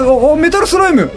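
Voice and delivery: usual speaking voice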